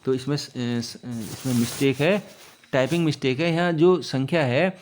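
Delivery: ordinary talk, nothing marked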